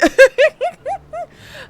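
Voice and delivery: high, loud squealing laugh. Real, not acted. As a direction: burst out in a shrill laugh. Laughs at raid message.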